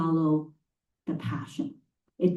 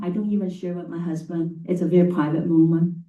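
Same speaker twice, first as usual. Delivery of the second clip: normal talking voice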